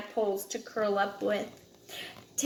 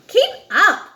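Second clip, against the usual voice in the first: loud high "Keep up!"; calling out; 9 semitones higher, 10 dB louder than usual. Acted. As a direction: shout it after the little one. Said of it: "they would say".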